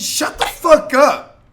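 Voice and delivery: slow, drawn-out furious yell, high and loud; angry shout. Acted. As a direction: spit it out at them, word by word. Telling racists to shut up.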